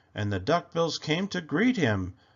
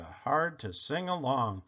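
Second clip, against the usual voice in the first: ordinary delivery, nothing marked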